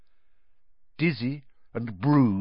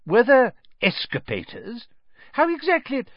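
excited questions, much higher; eager; 12 semitones higher, 4 dB louder than usual. Acted. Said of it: "said Mister Weasley eagerly".

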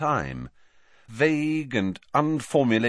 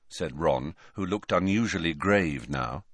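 ordinary delivery, nothing marked

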